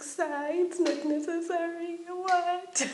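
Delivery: high, slow frightened plea; pleading. Retold or imitated. Schoolgirl begging the teacher to skip her caning. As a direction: beg the teacher not to cane. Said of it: "I'm like".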